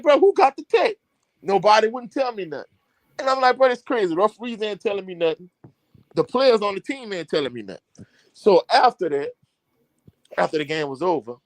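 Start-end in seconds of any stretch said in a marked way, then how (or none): 0.0-0.9 high, pressing question, much higher in pitch; baffled asking. Retold or imitated. Coach asking his players about the technical, high and baffled. 3.6-8.1 exasperated complaint. Retold or imitated. Coach frustrated nobody answers him.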